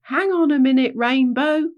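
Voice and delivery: high, loud call for someone to wait. A narrator's reading. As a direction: call ahead to the one in front. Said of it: "called Little Wee".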